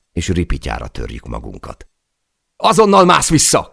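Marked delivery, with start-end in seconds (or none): 2.6-3.7 very high, loud urgent yell; shouted order. A narrator's reading. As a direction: scream the order up to him. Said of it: "kiáltotta Per". (sürgető, magas hangon kiáltott parancs)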